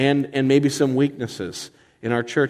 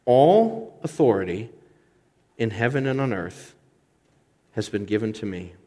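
deliberate re-reading; 2 semitones lower than usual. Shown as a demonstration. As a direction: let each phrase sink in, voice dropping away. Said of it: "Let me read that again".

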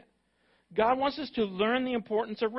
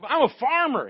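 mock excuse; 5 semitones higher, 8 dB louder than usual.

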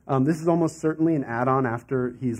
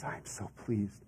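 much softer, lower and gentle; tender pleasure; 9 semitones lower, 15 dB quieter than usual. Acted. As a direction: breathe out pure approval. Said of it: "God says".

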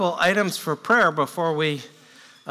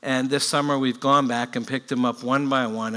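usual speaking voice

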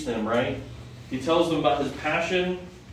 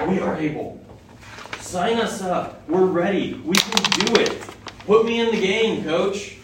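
keen, rising voice, louder on ready; like players begging the coach to send them in; a touch of hammed-up eagerness; eager volunteering; 6 semitones higher, 4 dB louder than usual.

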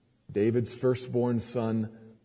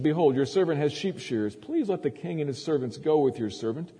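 higher-pitched, eager request; inviting prince; 6 semitones higher than usual. A narrator's reading. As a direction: make an earnest appeal to the king. Reads Absalom's invitation to the king.

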